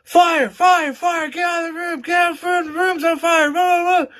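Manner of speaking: high, loud frantic shouting of fire; mock fire alarm yell. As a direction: yell fire to wake the sleeping boys. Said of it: "and she going".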